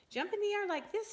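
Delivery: high, loud, bouncy coaching; eager instruction. Acted. Mister Bird teaching Junior to fly, high and eager. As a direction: cheer him on to jump. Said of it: "he said".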